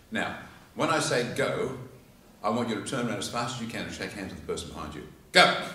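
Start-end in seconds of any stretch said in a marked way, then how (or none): 5.3-5.6 sudden loud shout of go; loud, laughing shout; shouted command. Acted. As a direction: bark the start signal loudly. Speaker shouts command to audience.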